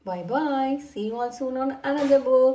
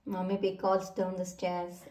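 usual speaking voice

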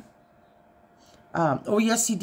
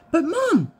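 whiny husband; 4 dB louder than usual. Retold or imitated. Husband pleads, high.

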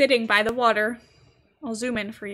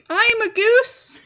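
playful; 9 semitones higher, 7 dB louder, slower than usual. Acted. Playfully declaring herself a goose.

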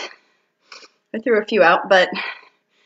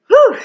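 loud, high blown-out whew; exhale whew; 11 semitones higher, 6 dB louder than usual. Real, not acted. Winded coach blows out a loud whew after the round. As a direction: blow it out after the effort.

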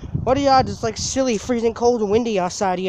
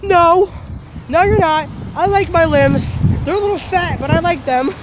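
cousin's silly reply; 7 semitones higher than usual. Retold or imitated. Cousin answering the scare actor's threat, high and playful. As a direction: squeak it out, cheekily defiant. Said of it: "my cousin just goes".

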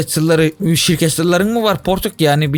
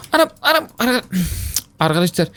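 ordinary talk, nothing marked

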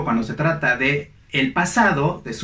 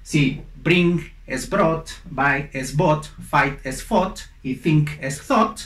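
slow recap; slower than usual. Shown as a demonstration. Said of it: "slow recap for learners".

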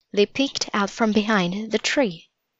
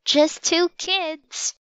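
put-on high, slow little-girl voice; small voice; 9 semitones higher, slower than usual. A narrator's reading. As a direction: answer timidly, pitched well up. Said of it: "answered in a small voice".

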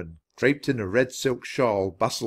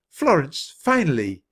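higher-pitched exclamation; exclaiming; 6 semitones higher, 4 dB louder than usual. A narrator's reading. Selina sees Florence arrive at last, exclaiming. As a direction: greet a long-awaited friend with a mild cry. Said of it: "exclaimed Selina".

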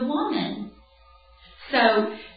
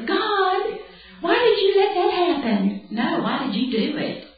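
much higher, sing-song complaint to God; whiny plea; 10 semitones higher than usual. Retold or imitated. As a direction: whine it up at heaven like a child. Whining prayer to God.

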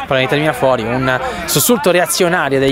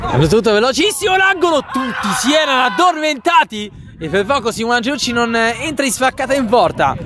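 excited commentary; 6 semitones higher than usual. Real, not acted. Near miss commentary.

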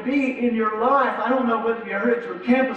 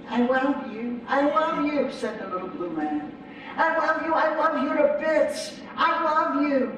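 high, sing-song little creature voice, much higher; little blue man; 6 semitones higher than usual. Acted. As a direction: coo it like a clingy little creature.

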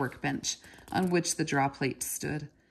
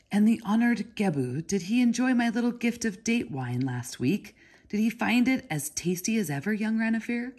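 put-on higher, reedy, wheedling Babylonian porter; fawning flute-like voice; 6 semitones higher than usual. A narrator's reading. Porter probes the boy about the date wine in a higher reedy wheedle. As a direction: pipe it up like a badly made flute, all oily flattery. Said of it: "His voice was like the sound of a badly made flute".

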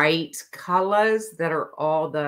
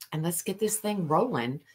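ordinary talk, nothing marked

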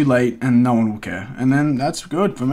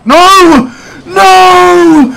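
1 anguished scream; 13 dB louder than usual. Real, not acted. Game loss, screaming in frustration.

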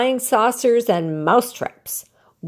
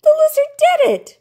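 excited shout; 8 dB louder than usual. A narrator's reading. Alexander realises the lizard's magic.

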